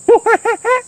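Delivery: high squeaky monkey laugh; a delighted little monkey chatter; monkey giggle. Acted. George laughs at the tents, high monkey giggle.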